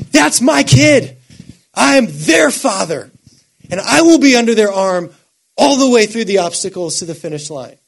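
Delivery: put-on a heavenly father shouting out for his child. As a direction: cry it out like a proud father. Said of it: "who is shouting about you".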